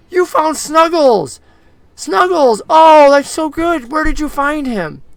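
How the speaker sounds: put-on a kid overjoyed at getting her toy back; excited little girl. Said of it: "She goes".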